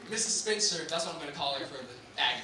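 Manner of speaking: ordinary talk, nothing marked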